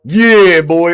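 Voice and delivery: loud pumped-up cheer; hype yell. Real, not acted. Streamer hyping his own takedown, loud cheer.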